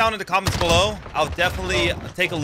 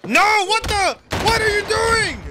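much higher, louder startled yelp; shocked outcry; 3 dB louder, slower than usual. Real, not acted. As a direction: jolt up in disbelief. Sudden shock during the match.

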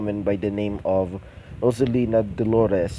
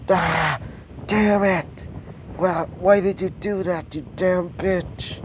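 mock screaming; 8 semitones higher than usual. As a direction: act out an exasperated scream, pitched well up. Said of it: "she starts screaming".

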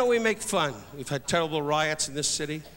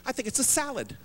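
quiet mock wife voice; wife insisting it's a salad, mildly mimicked; quoting wife; 5 semitones higher, 5 dB quieter than usual. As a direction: drop into a soft, put-on voice for the wife. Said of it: "She kept saying to me".

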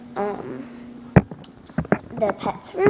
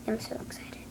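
whispering; 13 dB quieter than usual. Real, not acted. Excited secret about toy.

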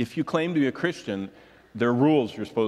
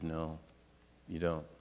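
low, very soft retold reply; subdued agreement; 7 semitones lower, 12 dB quieter than usual. Churchgoer agreeing with pastor, subdued. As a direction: drop right down and quietly agree.

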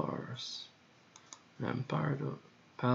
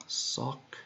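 normal talking voice

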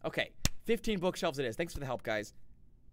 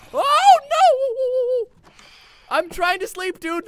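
a sudden high panicked cry, much louder; panicked yelp; 13 dB louder, much slower than usual. Real, not acted. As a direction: yelp in real alarm as the mobs appear. Phantoms attack while he sleeps.

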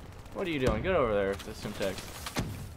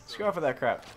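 normal talking voice

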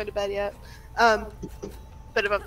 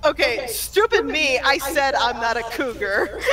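slow, higher-pitched flustered blurt; retold freak-out; 5 semitones higher, slower than usual. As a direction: retell a flustered outburst, drawn out and laughing at the end. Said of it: "we both freaked out".

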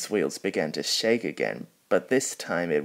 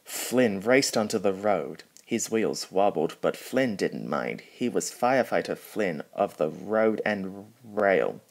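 usual speaking voice

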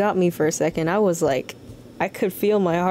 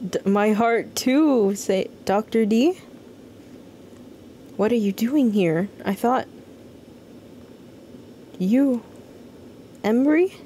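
voicing game character; 4 semitones higher than usual. Voices character reading dialogue aloud.

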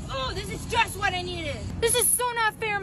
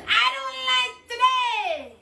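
higher, slow drawn-out whiny yell; tantrum wail; 6 semitones higher, slower than usual. Acted. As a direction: wail it like a sulking child. Kid's catchphrase tantrum.

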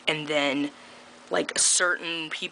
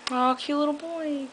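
cooing aside; 10 semitones higher than usual. Real, not acted. Spotting a little boy mid-vlog, a high coo.

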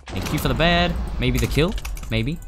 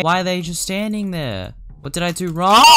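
loud, high, exasperated yell; frustrated shout; 7 semitones higher, 4 dB louder than usual. Real, not acted. Gamer yelling at enemies standing still, frustrated shout. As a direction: shout it at the players who won't move.

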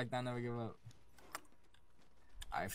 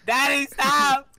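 high laughing scream; 14 semitones higher, 12 dB louder, much slower than usual. Pretend. Begging while laughing, dangled.

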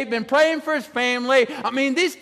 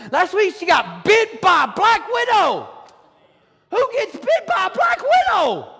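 higher, shouted disbelief; can you believe this happened; incredulous; 9 semitones higher, slower than usual. Real, not acted. Pastor telling church about Becky's spider bite, incredulous.

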